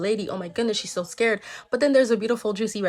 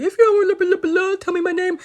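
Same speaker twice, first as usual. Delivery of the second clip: high, loud mock rant of an old lady; hammed-up yelling, blustering through blahs; mock demanding old lady; 9 semitones higher, 5 dB louder than usual. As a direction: imitate a ranting old woman, pitched way up. Imitating the angry lady's demand.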